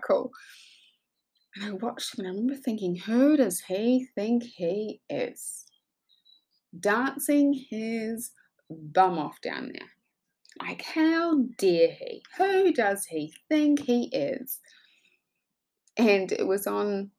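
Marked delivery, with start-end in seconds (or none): 2.9-14.6 drawn-out, scandalized inner voice; acted-out judgmental disbelief; indignant judging. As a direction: stretch each word out slowly in mock outrage. Mocking her own judgmental thoughts.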